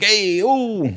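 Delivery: high, loud mobster "Hey, oh"; worked-up Italian. Retold or imitated. Friend imitating worked-up Italians, loud mobster call. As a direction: throw up your hands like an Italian guy. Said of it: "Italian people are just getting worked up".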